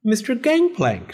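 high-pitched surprised call; exclaiming. A narrator's reading. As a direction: exclaim at finding him there. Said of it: "she exclaimed".

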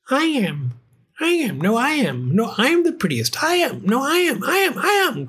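many cats crying over each other; squabbling cats. A narrator's reading. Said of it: "cried the hundreds and thousands".

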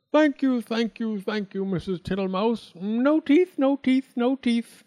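put-on high, loud, chirpy old toad voice; a greedy toad guest, all fawning thanks; high singsong toad; Mister Jackson the toad. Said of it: "said Mister Jackson".

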